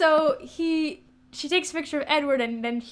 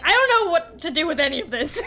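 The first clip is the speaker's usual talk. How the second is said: mock bewildered; 5 semitones higher, 5 dB louder than usual. Confused straight woman voiced.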